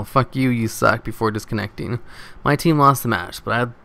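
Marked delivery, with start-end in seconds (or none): none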